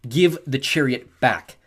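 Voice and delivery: slow, deliberately even; boy holding steady against the bully. A narrator's reading. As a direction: keep it level and controlled. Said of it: "I tried to keep my voice even".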